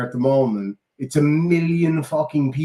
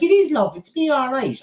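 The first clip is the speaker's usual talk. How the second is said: much higher-pitched put-on granny voice; Mad Irish Granny; 12 semitones higher than usual. Granny character tells a surprised fan it's really me, high put-on granny voice. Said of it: "Mad Irish Granny".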